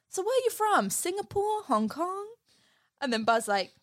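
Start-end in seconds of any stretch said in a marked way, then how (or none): 0.1-2.3 Ham voice. Retold or imitated. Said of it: "So Ham's like".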